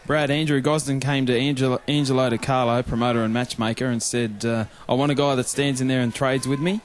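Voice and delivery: ordinary delivery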